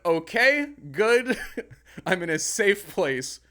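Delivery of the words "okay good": mock approval. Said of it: "And I went".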